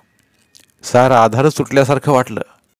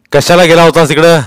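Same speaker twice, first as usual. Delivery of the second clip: loud, high questioning; stern interrogator; police inspector; 6 semitones higher, 8 dB louder than usual. A narrator's reading. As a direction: demand an explanation from the child.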